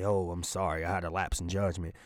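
sheepish fake contrition, mumbled low; a low, quiet, hollow sorry; mock apology. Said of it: "if you just say".

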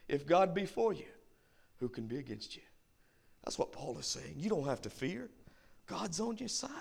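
quiet, tender reassurance; hushed preaching. Acted. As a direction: bring it right down to a hush. Soft repeat of the sermon climax.

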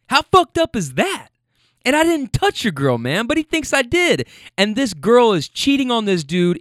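high, exasperated how fucked up is that; indignant rant. Retold or imitated. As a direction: vent at the unfair accusation. Guy's indignant rant about accusation.

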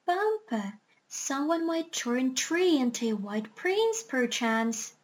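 ordinary delivery

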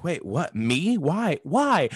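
rising, disbelieving "Why? Why?"; mock surprise. Imitating a surprised waiter getting a huge tip. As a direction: act out a stunned, delighted waiter.

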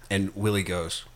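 normal talking voice

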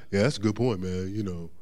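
low laid-back mocking voice; lazy brushing-off voice. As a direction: shrug it all off like a backslider. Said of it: "you be like".

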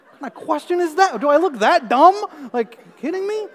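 high, loud, laughing disbelief; can't believe she asked that; incredulous. Retold or imitated. Patient balks at nurse's question, high and incredulous.

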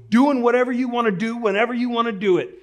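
high, loud booming sergeant bellow; drill sergeant shout. Retold or imitated. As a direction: boom it out like a drill sergeant barging in. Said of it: "to say in a loud voice".

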